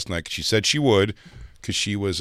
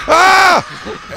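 high, loud yelp of panic; mock scream back in fright; startled scream; 9 dB louder than usual. His startled scream in reply.

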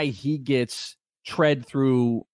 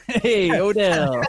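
mock call; 4 semitones higher, much slower than usual. Teasing Odell mockingly.

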